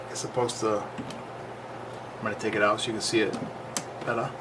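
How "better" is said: ordinary talk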